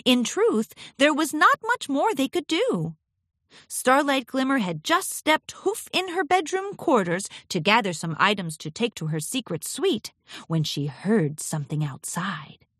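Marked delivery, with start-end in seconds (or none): none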